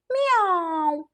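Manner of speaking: drawn-out imitated cat meow. Cat ends its request, long meow.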